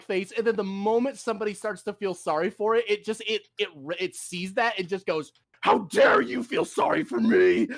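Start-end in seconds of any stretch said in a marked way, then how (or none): 5.6-7.7 high, loud indignant retort; mock outrage. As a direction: flare up like the offended creature. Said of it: "It just gets super pissed off".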